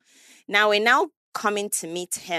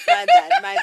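a much higher, louder "My bad" breaking into laughter; laughing loud apology; 9 dB louder than usual. Real, not acted. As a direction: blurt it out high and loud mid-laugh. Apologising through a laughing fit.

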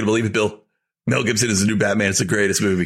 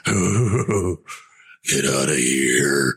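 much lower, slow mock Batman growl; bad Batman voice; 7 semitones lower, much slower than usual. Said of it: "it was the worst Batman impression".

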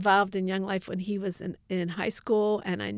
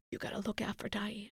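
whisper; 7 dB quieter, faster than usual. Retold or imitated. Dying sister's quoted words to Joe.

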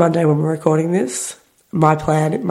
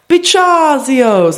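slow, high, loud mock Italian word; hammed-up Italian accent, drawn out; 8 semitones higher, 4 dB louder, much slower than usual. Shown as a demonstration. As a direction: stretch it out in a silly Italian accent. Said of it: "a funny little Italian accent".

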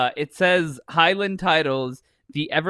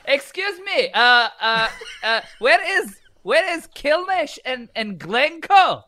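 put-on high, louder Indian-accented asking; hammed-up Indian accent, pitched way up; 10 semitones higher, 5 dB louder than usual. Lost visitor asking where Kilnash is, hammed-up Indian accent. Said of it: "do it in your Indian accent".

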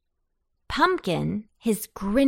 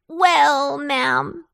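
high, loud drawn-out address; pumpkin replying courteously; 7 semitones higher, 6 dB louder than usual. A narrator's reading. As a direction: open grandly in a raised pumpkin voice. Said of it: "replied Pumpkin with a low bow".

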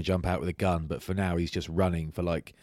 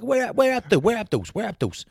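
high, loud frantic search for kit; frantic asking; 14 semitones higher, 6 dB louder than usual. Retold or imitated. Player retelling hunting for his shorts, high frantic asking. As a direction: panic about missing shorts. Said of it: "I was like".

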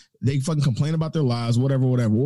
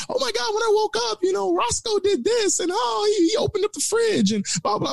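mocking high-pitched gushing coworker, much higher; gushing pet owner. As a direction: ham up an overexcited dog owner's chatter. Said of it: "And then they fucking go on for".

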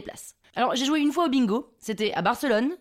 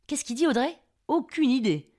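ordinary talk, nothing marked

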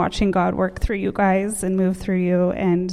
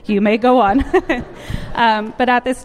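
laughing; 4 semitones higher, 4 dB louder than usual. Real, not acted. Joking farewell to students.

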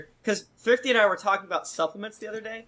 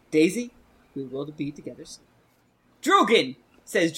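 usual speaking voice

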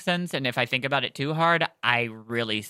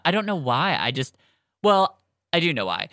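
normal talking voice

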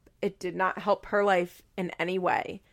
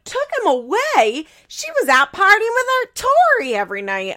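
much higher, louder outraged yell; mock shout; 11 semitones higher, 8 dB louder than usual. Grandmother shouts back at the boyfriend, high and loud.

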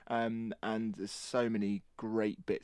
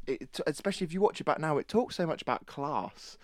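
ordinary talk

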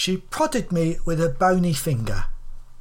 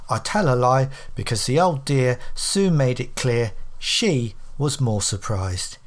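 usual speaking voice

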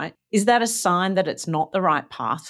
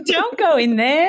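mock warning; 8 semitones higher than usual.

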